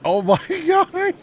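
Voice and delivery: loud, very high, drawn-out shriek with laughter; astonished yell. Real, not acted. Friend hears the date of the wrestling show, astonished yell. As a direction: shriek in amazed, laughing disbelief. Reacts to hearing February ninety-nine.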